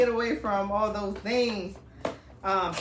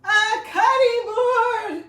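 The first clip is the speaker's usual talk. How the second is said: high squealing cry of delight, much louder; shrieking with joy; 14 semitones higher, 11 dB louder, slower than usual. Real, not acted. Unwrapping her sister's gift, she shrieks with joy. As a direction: let it burst out as a delighted scream.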